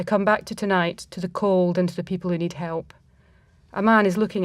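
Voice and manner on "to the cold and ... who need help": ordinary delivery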